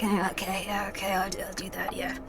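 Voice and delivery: low, soft mumble fading to a whisper; reluctant bog witch. Acted. Bog witch answering heroes who need her, reluctant, trailing off. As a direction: grudgingly agree under your breath as a bog witch. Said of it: "Bog Witch, we need you".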